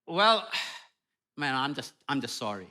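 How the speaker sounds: soft sighed mock apology; a weary husband apologizing with a sigh. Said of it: "He says".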